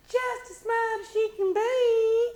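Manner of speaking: high loud amazed gush; so surprised. Acted. Mama marvelling at the baby, high and loud. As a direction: marvel at how smart she is. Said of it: "so surprised".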